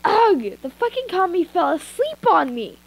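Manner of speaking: grossed out by the sleeper; irritated groan. Acted. Woken captive groaning at the sleeping captor. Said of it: "Let me redo that".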